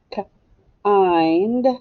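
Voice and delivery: "K-ind" as a short k, then a long drawn-out ind; sounded out. Shown as a demonstration. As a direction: stretch the ind in "K-ind", rising at the end. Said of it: "that is a glued sound".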